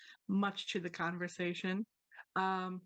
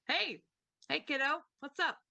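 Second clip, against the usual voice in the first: cheery crow greeting; 6 semitones higher than usual. Acted. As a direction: crow suddenly greets them like an old pal. Crow speaks English first time.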